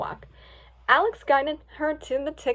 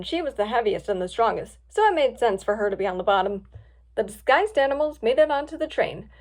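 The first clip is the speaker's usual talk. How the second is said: plain reading voice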